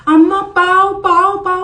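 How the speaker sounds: loud high yell; tutor shouting. Retold or imitated. Tuition teacher in mid-lesson shouting loud and high. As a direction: yell out the word over and over. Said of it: "जोराजोरात ओरडायला लागली".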